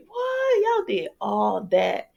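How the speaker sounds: high squeal of disbelief; shocked. Real, not acted. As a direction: react like you can't believe it. Shocked reaction to the scene.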